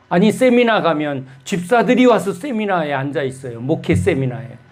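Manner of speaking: ordinary delivery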